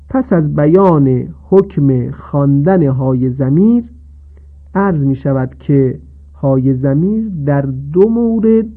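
ordinary talk, nothing marked

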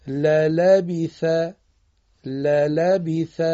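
slow model reading of للبیث, lamalabeeth; Arabic kasra. Shown as a demonstration. Teacher models Quranic pronunciation for pupils, slow and clear.

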